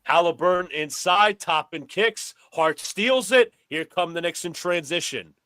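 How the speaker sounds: brisk sportscaster calling the action; play-by-play commentary. Pretend. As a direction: call it like a courtside announcer. Plays announcer over the game.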